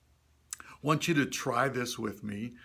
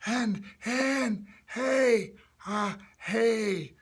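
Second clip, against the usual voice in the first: put-on high, airy old-timer voice; old person; 9 semitones higher, 3 dB louder, much slower than usual. Shown as a demonstration. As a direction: push lots of air through a tight throat. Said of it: "make an old person's voice".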